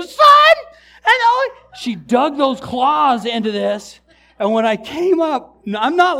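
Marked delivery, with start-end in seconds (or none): 0.0-1.5 high, loud screech mid-formula; comic high-pitched holler of sudden pain; pained yelp. Retold or imitated. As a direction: yelp the words as claws dig in. Clawed mid-baptism, cries out the words.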